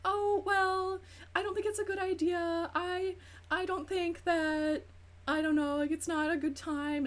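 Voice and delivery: high, meek, hesitant voice; timid hedging. Retold or imitated. Relatives gently objecting to the pregnancy, timid and high. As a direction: tiptoe around it like a nervous relative. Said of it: "everybody else was like".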